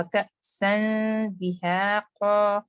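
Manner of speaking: کأسا دہاقا recited very slowly with the nasal ikhfa held; tajweed recitation. Shown as a demonstration. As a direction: stretch کأسا دہاقا with the tanween hidden in the nose. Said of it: "یہاں پر اخفا letter آئے گا". (کأسا دہاقا کو بہت آہستہ، اخفا کے ساتھ پڑھیں)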